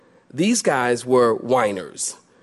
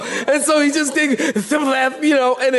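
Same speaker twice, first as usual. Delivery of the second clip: laughing; 10 semitones higher than usual. Real, not acted. Still laughing while retelling.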